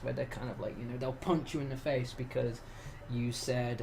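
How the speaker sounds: normal talking voice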